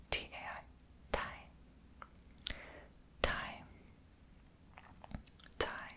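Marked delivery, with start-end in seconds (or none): none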